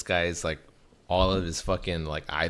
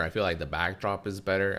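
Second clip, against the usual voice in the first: ordinary talk, nothing marked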